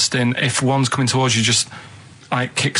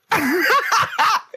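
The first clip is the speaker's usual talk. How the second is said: high laugh. Real, not acted. Laughs at own joke.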